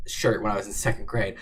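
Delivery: normal talking voice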